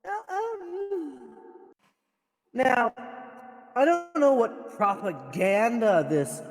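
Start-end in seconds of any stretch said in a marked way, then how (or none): none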